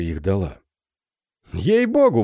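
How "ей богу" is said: высоко и громко, в восторге; радостно воскликнуть; восклицание. Acted. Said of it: "воскликнул он". (удивлённо воскликнуть)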